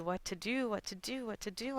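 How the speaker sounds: soft, anxious repeated muttering under the breath; worried fretting. Retold or imitated. Grandmother worries to herself over the foxhole, soft and anxious. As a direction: fret quietly to yourself, at a loss.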